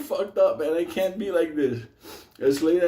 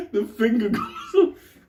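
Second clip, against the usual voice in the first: laughing; 4 semitones higher, slower than usual. Real, not acted. Laughing at his own story.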